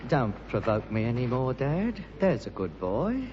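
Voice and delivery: low, soft threat; quiet menace. Acted. Son quietly warning his father not to push him. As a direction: hold the anger under your breath.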